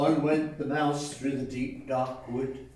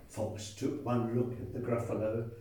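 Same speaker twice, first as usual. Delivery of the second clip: usual speaking voice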